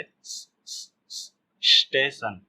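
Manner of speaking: repeated bare "sss" before स्टेशन; drawn-out half S. Shown as a demonstration. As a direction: hiss the s alone, then complete स्टेशन. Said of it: "थोड़ा सा इसे".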